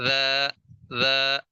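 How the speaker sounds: "ذا" said thin and light; thin light letter. Shown as a demonstration. Tajweed teacher contrasts the thin "ذا" for students. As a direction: keep "ذا" fine and light. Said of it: "ذال کو بھی میں ذا کر کے پڑھوں گا".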